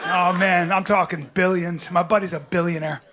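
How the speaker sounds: ordinary delivery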